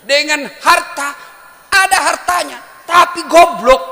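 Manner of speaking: full-force preaching. Acted. Preacher mocking wealth without knowledge, full-force shout.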